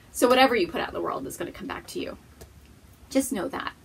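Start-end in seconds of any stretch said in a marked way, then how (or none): none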